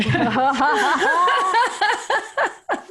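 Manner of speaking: high, real bright laughter; burst of laughter. Real, not acted. Host laughing as the bead pops out. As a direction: laugh out with relief. Relief laugh after story.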